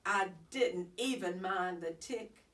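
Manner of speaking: plain reading voice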